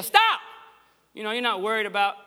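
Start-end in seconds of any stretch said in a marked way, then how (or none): 0.0-0.4 sudden high shouted stop; parent's alarmed shout; yell. Retold or imitated. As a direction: yell it like a car is coming. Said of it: "you just yell".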